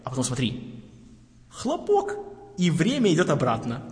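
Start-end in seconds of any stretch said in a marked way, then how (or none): 1.5-2.2 high, dramatic bang. Real, not acted. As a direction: say it like a sudden pop. Sound of the bang.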